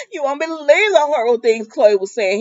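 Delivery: high, tearful imitation; mock upset. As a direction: retell it as a put-on upset wail. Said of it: "she all upset".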